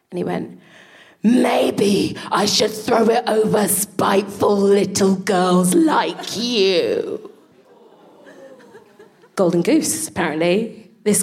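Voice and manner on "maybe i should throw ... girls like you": very slow, drawn-out scolding; menacingly slow teacher voice; teacher's spiteful put-down. Retold or imitated. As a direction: stretch every word out at the child. Teacher snaps at child, Mister P.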